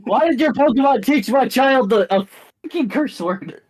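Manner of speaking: high, loud indignant demand; outraged parent. Acted. Angry mother confronting a trainer over cursing, outraged yell.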